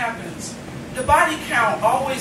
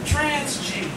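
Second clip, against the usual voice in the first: poem recitation; 3 semitones lower, slower than usual. Acted. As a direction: read it tenderly to the room. Recites poem about student's dad.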